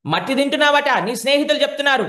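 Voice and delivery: loud, high, fast scolding; mother scolding Krishna loudly and fast. Acted. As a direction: rattle off the accusation.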